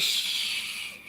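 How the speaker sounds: whispered shh spray sound; spraying hiss. Pretend. Vlogger miming her hair spray with a hush. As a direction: mimic a spray bottle with a soft hiss. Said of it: "And I'm gonna be like".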